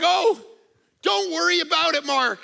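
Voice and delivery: nonchalant friend. Retold or imitated. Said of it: "he's like".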